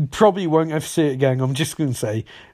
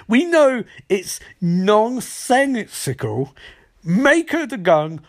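slow emphatic explaining; 6 semitones higher, slower than usual. Retold or imitated. Mobsters lecturing Cisco slowly.

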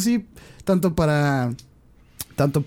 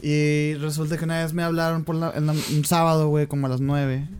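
normal talking voice

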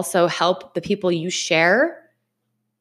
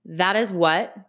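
ordinary talk, nothing marked

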